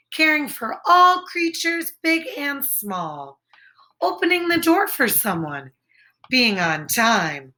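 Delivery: ordinary delivery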